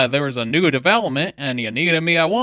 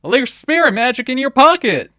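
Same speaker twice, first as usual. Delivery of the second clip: alarmed; 9 semitones higher, 8 dB louder than usual. Acted. Alarmed warning about glowing shard.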